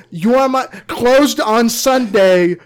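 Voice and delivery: loud, high, drawn-out crooning; sing-song mock love line; hammed-up romantic croon; mock serenade. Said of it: "The man who talked about death and taxes".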